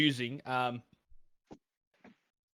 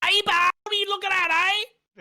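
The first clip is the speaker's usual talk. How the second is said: high, loud aussie eshay lad voice; mock a rough train-station eshay; 10 dB louder, faster than usual. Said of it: "they sound like this, they go".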